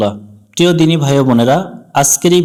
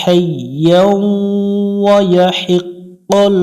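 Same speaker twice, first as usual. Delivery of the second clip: slow melodic tajweed chant; Quran recitation; 4 semitones higher, much slower than usual. Acted. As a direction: draw out every vowel in recitation. Full verse recitation. (ধীর সুরেলা কুরআন তিলাওয়াত)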